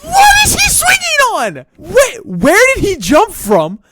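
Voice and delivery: real exasperated shouting; worked up. Real, not acted. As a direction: rant at the screen, voice cracking high. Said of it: "I'm getting too worked up".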